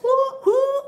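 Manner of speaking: high whooping cheer; mock whoo cheer. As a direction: whoop like a hyped crowd.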